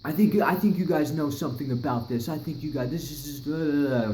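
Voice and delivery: high, pushy accusation; accusing neighbor. Retold or imitated. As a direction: press them like you know they did it. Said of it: "we start getting an argument".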